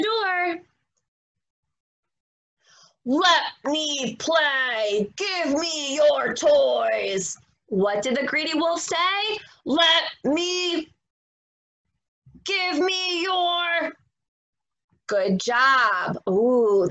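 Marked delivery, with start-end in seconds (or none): 3.1-7.4 slow, growly greedy wolf demand; drawn-out, bossy wolf voice; mean wolf voice. Acted. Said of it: "talking in his mean, angry voice". 9.7-13.9 greedy wolf. Acted. Children repeat wolf line.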